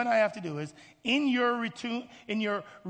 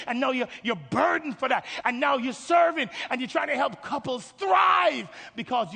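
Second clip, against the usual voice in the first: higher, loud surge of fervour; full-force preaching; 5 semitones higher, 6 dB louder than usual. Real, not acted. Preacher describing a calling to help couples, fervent shout. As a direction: build to a shout on the key word.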